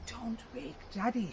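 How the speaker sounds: put-on mother hissing to stop the boy; angry hiss. A narrator's reading. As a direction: hiss it angrily at the child. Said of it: "she hissed angrily".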